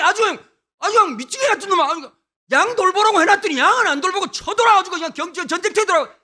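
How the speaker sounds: loud, high Gyeongsang dialect rant; 경상도 사투리로 버럭 화내는 형; furious Gyeongsang big brother. Retold or imitated. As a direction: tear into your little brother in thick Gyeongsang speech. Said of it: "경상도 엘리압 한번 만나보시겠습니까".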